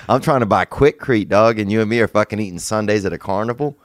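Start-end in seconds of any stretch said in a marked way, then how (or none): none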